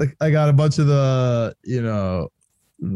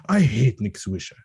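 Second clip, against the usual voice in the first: lightly imitated rant of hatred; Ozzie Guillen; 4 semitones lower than usual. Fan quoting a clip about Nick Swisher, lightly mimicked loathing. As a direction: lean on hate, mimicking a viral clip. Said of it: "you know the Ozzie Guillen, uh, clip".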